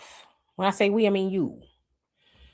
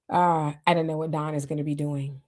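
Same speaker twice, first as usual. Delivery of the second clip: usual speaking voice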